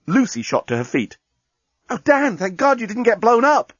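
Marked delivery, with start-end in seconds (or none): 1.9-3.7 high-pitched burst of relief; excited relief. Acted. Lucy greets Dan with relief.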